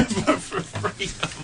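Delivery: words through laughter; laughing out freedom. Real, not acted.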